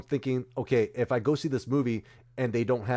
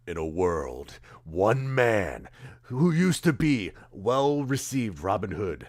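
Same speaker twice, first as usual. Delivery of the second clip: slow, dramatic trailer narrator drawl; movie trailer voice; much slower than usual. Retold or imitated. Hyping a movie to an audience, slow trailer-narrator voice. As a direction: boom it out like the guy who narrates every trailer. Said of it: "He's the one who says".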